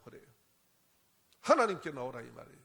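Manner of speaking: ordinary talk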